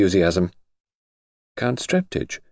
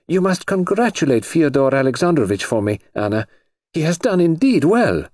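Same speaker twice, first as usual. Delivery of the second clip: cartoon princess; 9 semitones higher, 3 dB louder than usual. Cheerful Grand Duchess.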